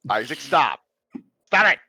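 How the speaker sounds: loud, high, sharp order to stop; exasperated shout. Acted. Kinnick telling teasing Isaac to stop, exasperated shout. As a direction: shout it, rising on the repeat. Acted scolding of a teasing companion.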